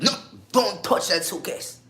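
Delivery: high, loud, slow guard's shout; acted shouted warning. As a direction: warn someone off sharply, at full voice. Guard yells at him not to touch.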